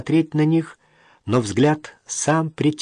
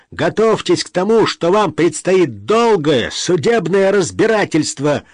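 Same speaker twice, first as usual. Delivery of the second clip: loud, raised-pitch angry tirade; seething; 6 semitones higher, 5 dB louder than usual. A narrator's reading. Brother threatens a court fight over the will, seething. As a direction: rage on, hammering each phrase. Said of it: "кипятился его брат".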